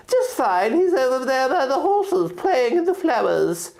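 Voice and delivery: bright high fairy reply. Acted. Arya the fairy answering about the baby, high and light. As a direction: chirp it like a tiny fairy.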